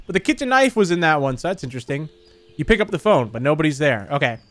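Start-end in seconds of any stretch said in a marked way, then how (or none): none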